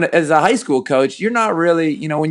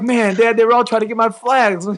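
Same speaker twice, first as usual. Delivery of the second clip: higher, mock aggrieved kid; lightly voiced whiny little boy, higher; son's voice, amused; 8 semitones higher than usual. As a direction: imitate the boy while fighting laughter. Father retelling son's complaint.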